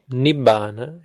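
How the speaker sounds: "nibbana" with held nib and long stressed BAA; correct long syllable. Shown as a demonstration. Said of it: "Penultimate syllable is long".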